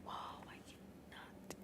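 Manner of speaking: hushed, voiceless ASMR narration; whispered story reading. Acted. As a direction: read the story entirely in a whisper.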